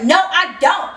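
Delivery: acted angry comeback; mad retort. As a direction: snap back, offended. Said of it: "so I got really mad".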